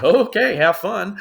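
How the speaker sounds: bright high sarcastic okay have fun; mock cheerful. Waiter quitting on his manager, sarcastic and high. Mocking boss while quitting.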